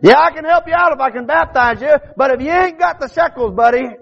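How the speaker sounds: high, rising revival preaching; preaching at full force. Acted. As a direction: preach it out to the pews. Preacher builds the parable.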